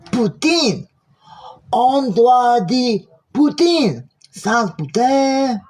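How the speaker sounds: high-pitched Québécois indignation; mock French outrage. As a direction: scold slowly and high in French, stretching the key words. Offended reply to mispronounced order.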